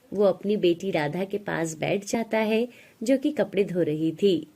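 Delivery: ordinary delivery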